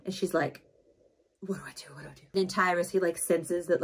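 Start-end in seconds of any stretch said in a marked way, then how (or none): 1.4-2.2 whispered panic, no voice at all; panicked whisper. Retold or imitated. Nemesis panicking at the ball, whispering to herself. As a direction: breathe it out in a frantic whisper. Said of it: "she's freaking out".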